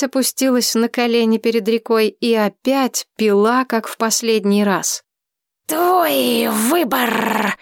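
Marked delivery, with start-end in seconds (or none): 5.7-7.5 slow, drawn-out dragon voice. Acted. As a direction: let the dragon drag it out. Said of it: "сказал дракон".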